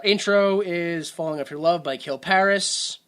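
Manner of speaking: ordinary delivery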